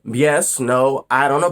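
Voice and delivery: ordinary talk, nothing marked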